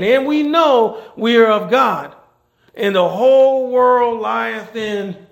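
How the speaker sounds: slow, loud reading of the verse; scripture read with force. Acted. Preacher reading scripture aloud to the church, slow and loud. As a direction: proclaim the verse slowly.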